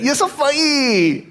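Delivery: put-on squeaky little kid with unfinished speech; small child. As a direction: imitate a toddler's high, slow "funny" without the n. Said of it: "he couldn't say funny".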